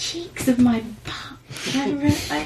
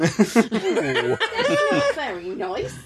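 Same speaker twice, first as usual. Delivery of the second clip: stretched, laughing protest; slow mock hurt; much slower than usual. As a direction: drag it out, mock offended.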